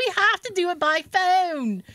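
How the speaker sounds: slow, high mock-British woman; hammed-up British call-centre voice; horrible British accent. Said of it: "I do a horrible British accent".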